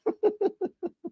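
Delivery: short, high, quiet chuckle; a little laugh to close the story; soft laugh. Real, not acted. Laughs after the Fedorov story.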